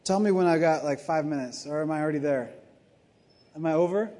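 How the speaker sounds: usual speaking voice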